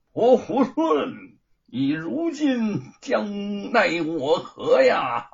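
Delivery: slow, high, loud taunt; drawn-out gloating taunt; mocking shout. A narrator's reading. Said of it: "对行者高叫道".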